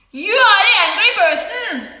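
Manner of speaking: high, heated accusation. Retold or imitated. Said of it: "I'm using a loud voice".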